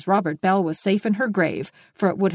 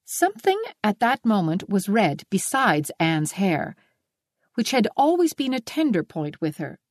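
ordinary delivery, nothing marked

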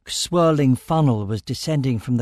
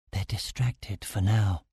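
low, soft and slow; hushed confiding; 4 semitones lower, 6 dB quieter than usual. A narrator's reading. Stellos tells the narrator they are unwatched, hushed. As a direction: confide it so nobody overhears. Secretive remark after breathing reassurance.

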